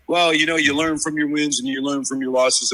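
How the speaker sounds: mocking Joe Burrow. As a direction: parody a player's canned excuse. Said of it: "You know what Burrow said".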